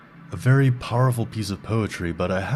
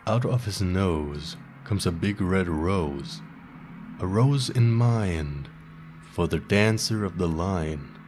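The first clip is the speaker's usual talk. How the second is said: slam poetry reading. Acted. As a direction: perform it like a slam poet on a small stage, letting each line land. Host performs slam poem on air.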